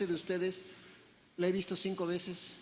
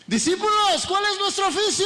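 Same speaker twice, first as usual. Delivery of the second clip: much higher, much louder rallying cry; full-force shout; 12 semitones higher, 12 dB louder than usual. Real, not acted. Pastor leads the congregation, full-force rallying shout. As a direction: shout it with everything. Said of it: "vamos a hacer un esfuerzo".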